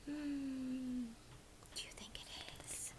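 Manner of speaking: hushed, quick wondering question; soft whispered guess. Real, not acted. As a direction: whisper it, full of suspense.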